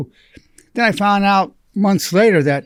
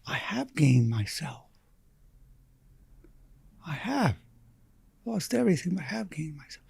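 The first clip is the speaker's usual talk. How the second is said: hushed awe; 9 dB quieter than usual. Retold or imitated. Patient realizing, awed.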